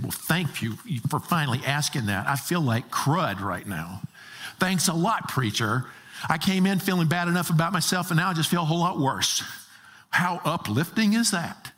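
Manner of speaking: mock-grateful complaint, pitch jumping up; sarcastic listener. Congregant griping to the preacher, sarcastic. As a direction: grumble sarcastically, quickening as it goes. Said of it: "You think".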